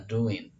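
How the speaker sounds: "doing" said as "doin", no final g; dropped G. Shown as a demonstration. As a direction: end "doing" on a plain n. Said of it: "drop the G atau G-nya dihilangkan".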